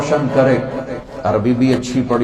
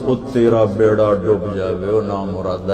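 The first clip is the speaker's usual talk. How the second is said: mock lament; 6 semitones lower, 4 dB quieter than usual. Rumi laments his drowned books.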